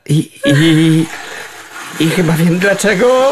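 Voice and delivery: wyżej i głośniej, z rosnącą obawą; zająknij się ze strachu na wysokości; nerwowe zająknięcie. Acted. Kot utknął wysoko na karniszu. (przestraszone, wysokie jąkanie)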